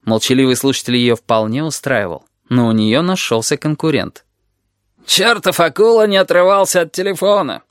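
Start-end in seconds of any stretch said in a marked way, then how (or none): none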